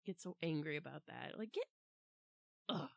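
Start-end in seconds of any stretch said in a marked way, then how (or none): none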